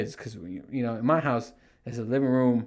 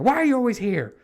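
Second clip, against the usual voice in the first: high, loud exasperated yell; angry; 9 semitones higher, 5 dB louder, faster than usual. Retold or imitated. Dad yelling at kids who keep showing up, angry. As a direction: shout them back to their room. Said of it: "I just got angry".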